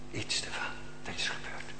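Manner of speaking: hushed whisper of awe; trembling whisper. Real, not acted. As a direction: breathe it out reverently. Awe at seeing God.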